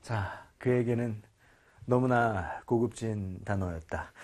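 low, slow documentary-style narration; slow, solemn storyteller aside; mock narrator. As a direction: narrate his defeat in a soft, low voice. Mock narration of the student's failure.

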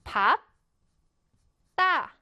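밥 따 in two separated syllables, silent ㄹ; model pronunciation. Shown as a demonstration. Teacher showing how the spelling is read, model pronunciation of 밥 따. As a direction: say 밥, pause, then a tense 따. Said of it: "읽을 때는 어떻게 읽냐면".

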